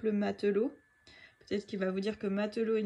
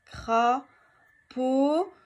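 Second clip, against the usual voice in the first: crapaud said loud, leaning on the final O; stressed crapaud; 2 semitones higher, 6 dB louder than usual. Shown as a demonstration. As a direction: hit the pau of crapaud hard. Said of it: "où est-ce que j'entends O dans crapaud".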